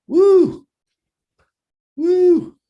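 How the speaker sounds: high, loud "woo"; high whoop. Real, not acted. Reacting to a recount figure, high loud whoop.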